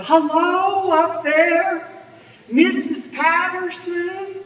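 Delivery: high, loud, drawn-out call. A narrator's reading. Villain hailing an old lady up a tree, calling up. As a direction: call up to someone high above. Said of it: "he called up".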